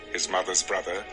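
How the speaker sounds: normal talking voice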